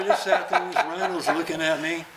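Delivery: ordinary talk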